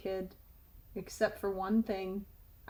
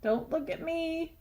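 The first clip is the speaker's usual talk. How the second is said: chewing; 5 semitones higher, 3 dB quieter than usual. Acted. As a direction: mouth full. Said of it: "chewed Stuart".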